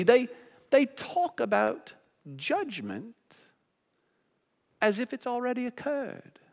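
high, halting mock British accent with long pauses; hammed-up British gentleman, weighing each phrase. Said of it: "this is my best British accent".

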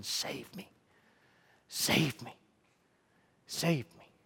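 hushed, low mock cry for help; faint retold plea, repeated with pauses; screams voiced as whisper. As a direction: imitate distant screams in a near-whisper. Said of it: "people across your city screaming".

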